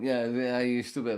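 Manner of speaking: high, loud jeering; hammed-up jeer from the other man; mocking the heckler. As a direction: taunt like a schoolyard bully. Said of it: "he's still going".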